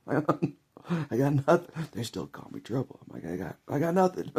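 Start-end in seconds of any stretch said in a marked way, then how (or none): 0.1-1.9 laughing it out, slow and high; cracking up while pleading innocence. Real, not acted. Vlogger laughing about being called Trouble, high slow laugh. 3.3-4.3 high, loud mock plea of innocence. Vlogger playing innocent about his nickname, mock loud plea.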